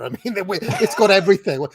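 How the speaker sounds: laughing through the words, pitch up. Real, not acted. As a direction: crack up while saying it. Laughing at self-parody.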